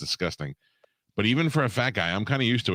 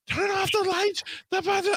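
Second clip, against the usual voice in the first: much higher shrill mock woman voice; frantic woman. Imitating the turtle lady at the door, high-pitched. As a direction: squeal it like a panicked neighbor. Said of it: "And she goes".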